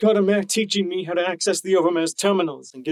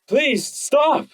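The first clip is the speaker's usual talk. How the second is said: higher, pleading shout; yelled; 9 semitones higher than usual. A narrator's reading. Stallion begging the guard to stop beating him, yelling. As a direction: cry out to make them stop. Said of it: "yelled U twenty".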